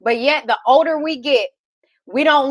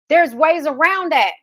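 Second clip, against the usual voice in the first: usual speaking voice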